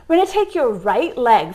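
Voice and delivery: usual speaking voice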